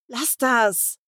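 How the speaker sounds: curt snap. Acted. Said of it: "sage ich barsch".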